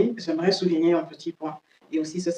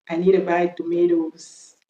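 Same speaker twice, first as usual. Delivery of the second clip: American "tomatoes", to-MAY-does, slowly; American accent. Shown as a demonstration. Teacher showing the plural, American accent. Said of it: "l'accent américain".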